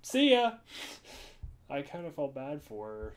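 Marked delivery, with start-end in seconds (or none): 0.0-0.5 much higher, louder, breezy imitation; mock oblivious cheer. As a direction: mimic someone missing the flirting completely. Imitates friend's oblivious goodbye.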